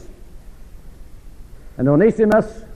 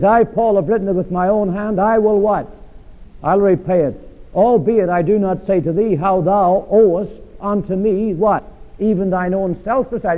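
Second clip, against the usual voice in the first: preaching read; 5 semitones higher than usual. Acted. Reading Paul, preaching force.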